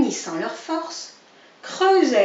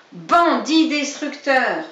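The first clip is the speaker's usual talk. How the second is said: slow, louder yell of an insult; a mild hammed-up yell; 4 semitones higher, 7 dB louder, slower than usual. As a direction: hurl the insult at him. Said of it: "hurlait monsieur Brun".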